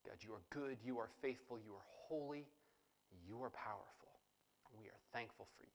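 soft, low reverent prayer. Real, not acted. Pastor opening the service, praying softly to God. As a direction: pray quietly and humbly to God.

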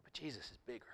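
soft, hushed whisper; hushed reverent whisper. Real, not acted. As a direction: whisper it like a secret comfort. Whispered sermon point.

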